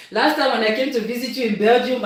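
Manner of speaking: ordinary delivery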